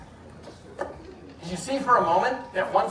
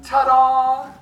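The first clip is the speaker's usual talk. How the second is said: loud, higher, drawn-out ta-da; showy ta-da; 5 semitones higher, 7 dB louder than usual. Shown as a demonstration. Juggler showing a volunteer what to shout, showy. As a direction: sing out a stagey ta-da. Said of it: "can you say".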